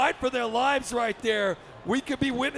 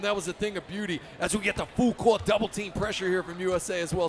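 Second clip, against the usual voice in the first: ordinary delivery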